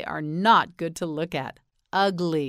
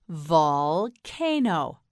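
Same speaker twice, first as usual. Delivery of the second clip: "Volcano" very slowly as vol... cano; broken into syllables; 3 dB louder, much slower than usual. Shown as a demonstration. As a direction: stretch and split "Volcano". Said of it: "You say it".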